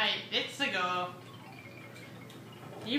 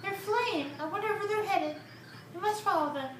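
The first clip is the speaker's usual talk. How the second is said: high character voice; 9 semitones higher than usual. Acted.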